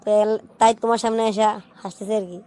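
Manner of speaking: ordinary talk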